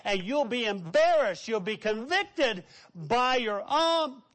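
fervent sermon climax; preaching at full force. Acted. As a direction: drive the point home to the congregation.

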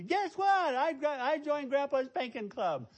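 put-on very high, loud little-girl squeal; imitated excited child. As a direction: announce big news like a thrilled child. Child excitedly announcing her news.

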